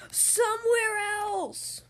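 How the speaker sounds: slow, high, pleading whine; whiny. Acted. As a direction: drag out the complaint. Elephant whines about birds.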